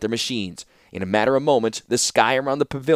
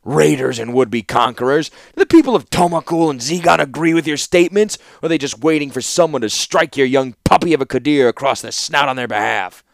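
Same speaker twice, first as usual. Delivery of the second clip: heated, louder warlord's retort; snarl; 4 semitones higher, 5 dB louder than usual. A narrator's reading. Warlord interrupting the envoy's speech at the conference, snarling. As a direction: cut in with a scornful snarl. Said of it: "interrupted with a snarl".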